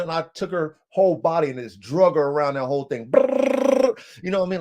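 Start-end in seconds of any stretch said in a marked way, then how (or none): 3.1-4.0 loud high trilled "brrr"; rolling rattle like a stick on bars; imitated rattling noise. Owner imitating dog dragged along kennel, loud trilled rattle. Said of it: "take a stick to your".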